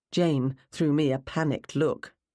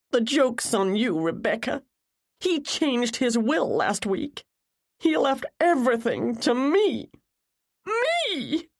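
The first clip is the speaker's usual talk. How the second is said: high-pitched, vindictive crowing; upset but victorious outburst; tearful triumph; 9 semitones higher, slower than usual. A narrator's reading. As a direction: build to a very high, gloating peak on Me. Jennifer's tearful triumph over her rival.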